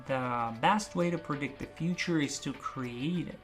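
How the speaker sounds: soft, slow, careful phrase; slow practice. Shown as a demonstration. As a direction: practise it slowly and quietly. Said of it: "increase the pace gradually".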